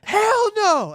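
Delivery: very high, loud, drawn-out hell no; hammed-up refusal. Margaret refusing to let Kevin stay over, shrieking mock refusal. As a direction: wail the refusal over the top.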